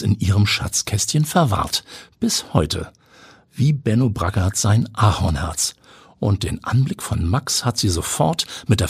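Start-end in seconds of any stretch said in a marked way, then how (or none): none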